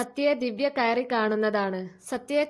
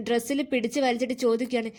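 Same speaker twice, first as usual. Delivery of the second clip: usual speaking voice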